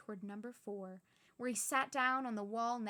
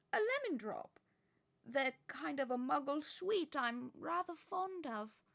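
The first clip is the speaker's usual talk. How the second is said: much higher, slow fond explanation; delighted old wizard; 5 semitones higher, 4 dB louder than usual. Acted. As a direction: savour it like a treat. Dumbledore explains.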